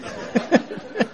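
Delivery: breathy high laugh. Real, not acted. Preacher laughs at his own punchline, airy laugh. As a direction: laugh at the joke.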